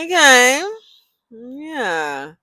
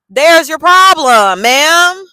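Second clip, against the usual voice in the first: loud, high exasperated; high, louder, drawn-out exasperation; 9 semitones higher, 12 dB louder, much slower than usual. Real, not acted. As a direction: call out her mistake, loud and slow. Exasperated rant at coworker.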